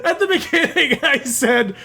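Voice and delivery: high, drawn-out words through laughter; laughing hard. Real, not acted. Host catching his own contradiction about Overwatch, helpless real laughter. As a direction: squeeze the words out while cracking up. Cracks up recalling own remark.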